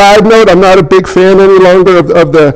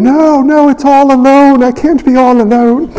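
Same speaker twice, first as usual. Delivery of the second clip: put-on higher whiny scared child voice; whiny scared kid; 6 semitones higher than usual. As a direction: plead like a frightened kid. Scared teen self quoted.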